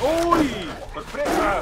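high, loud shocked shout; sudden loud scared yelp; loud startled surprise. Real, not acted. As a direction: jump and yell out. Surprise attack in game.

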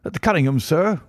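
high-pitched, agitated servant bringing news; gasping butler. A narrator's reading. Shaken butler rushes in to his master, gasping. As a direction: burst out with it, pitch jumping up. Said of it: "he gasped".

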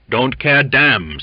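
loud, slow, pushed-out defiance; forced energy. A narrator's reading. Weak sick man blusters at the cook, forced loud defiance. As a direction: fake a burst of strength. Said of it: "said Waite with factitious energy".

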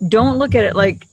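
usual speaking voice

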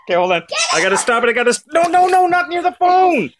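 loud, rising panicked yell; alarmed shouting. Real, not acted. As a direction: shout to keep the water away. Water spraying near her phone.

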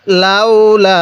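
লাউলা with a long held final "laa"; slow model recitation. Shown as a demonstration. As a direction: stretch out the end of লাউলা. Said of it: "আমার সাথে পড়ুন".

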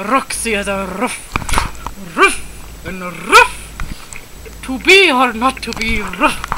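slow, hammed-up thespian declaiming; theatrical dog. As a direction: declaim like a stagey bulldog, barking each "ruff". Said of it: "They're very theatrical dogs".

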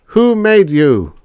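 high, loud, drawn-out call; big projected question, pitched high. A narrator's reading. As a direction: call down slowly to a crowd. Calling to the robot horde below.

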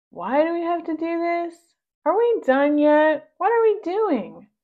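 high, complaining pug whine; whiny pug voice. Acted. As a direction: whine like a bored pug at work. Pugs complaining at work.

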